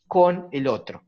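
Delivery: CON set apart after a pause, stressed. Shown as a demonstration. Speaker contrasting accompaniment with help, stress on CON. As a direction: lean on "con", then let "el otro" fall.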